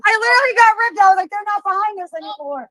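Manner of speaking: excited loud outburst. Real, not acted. Excited retelling outside court.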